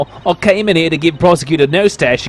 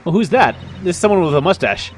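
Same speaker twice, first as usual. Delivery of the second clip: ordinary delivery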